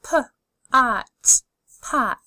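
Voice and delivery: d, ot, dot, parts pulled apart then joined; D, ot, dot: each part separate, then the whole word; segmented then blended. Shown as a demonstration. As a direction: sound it out and blend it. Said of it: "Keep saying 'em out loud".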